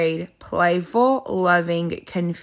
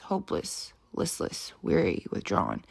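fading whisper; 11 semitones lower, 10 dB quieter than usual. Acted. Fades out on depressed words.

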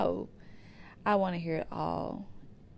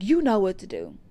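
mom urging firmly; 9 dB louder than usual. Retold or imitated. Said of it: "she was like".

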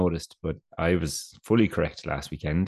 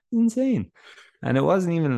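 incredulous; 11 semitones higher, 5 dB louder than usual. Real, not acted. Disbelief at long haircut.

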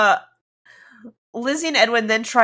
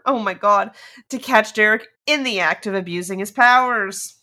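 ordinary delivery

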